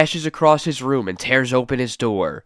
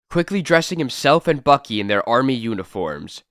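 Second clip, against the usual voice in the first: ordinary talk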